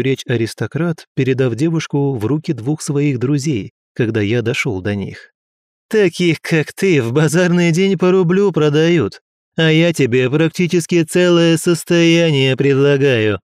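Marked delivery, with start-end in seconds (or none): 5.9-13.4 sneering high-pitched nobleman, louder; scornful rich bully talking down; arrogant aristocrat. Acted. Aristocrat keeps insulting girl.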